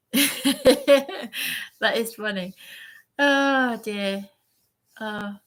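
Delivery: slow words through laughter; laughing. Real, not acted. Host laughing at the viewer's crap-in-a-bag joke. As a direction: let the laugh carry each word out slowly.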